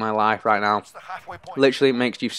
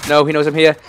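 loud, raised alarm; alarmed shout; 4 semitones higher, 8 dB louder than usual. Real, not acted. Gamer spotted just short of the nuke, alarmed shout. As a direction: call out in sudden dread. Spotted by enemy near goal.

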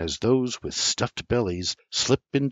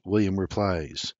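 ordinary delivery, nothing marked

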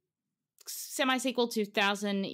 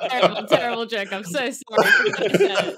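loud, higher, through helpless laughter; laughing apology; 4 semitones higher, 12 dB louder than usual. Real, not acted. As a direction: apologise while cracking up. Laughs at own bad joke.

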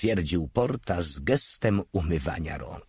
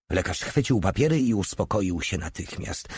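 ordinary delivery

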